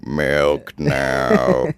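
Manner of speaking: put-on drawn-out demonic baby demand; possessed baby, low voice. Joking he was a possessed baby, low-voiced demand. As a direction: intone it like a mock possessed infant. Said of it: "I was a possessed baby, just, like, with a low voice".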